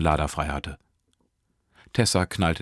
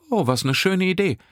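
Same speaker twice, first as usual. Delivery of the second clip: much higher, louder delighted exclamation; 14 semitones higher, 5 dB louder than usual. A narrator's reading. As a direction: gush with joy. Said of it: "freute sich Nesrin". (Nesrin freut sich, viel höher)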